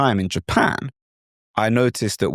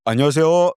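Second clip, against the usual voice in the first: Korean greeting; 6 semitones higher, 3 dB louder, much faster than usual. Shown as a demonstration.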